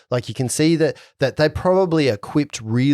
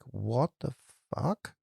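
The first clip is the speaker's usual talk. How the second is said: very soft, muttered disbelief; sketchy look; 12 dB quieter, slower than usual. Acted. Guards stop and stare at him, muttered. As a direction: breathe it out under your breath. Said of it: "give him a sketchy look like".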